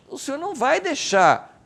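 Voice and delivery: loud, high declaration of faith; acted psalmist's bold assertion; fervent conviction. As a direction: cry it out to God with total confidence. Acts out the psalmist's confidence.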